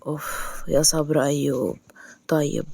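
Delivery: slow, low, soft groan of patience; exasperated huff. A narrator's reading. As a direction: give in with a weary huff. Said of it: "قصي اتقفقف بصوت عالي".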